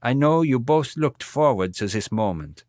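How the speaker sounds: put-on a mourning old man with a heavy German accent. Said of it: "in his heavy German accent".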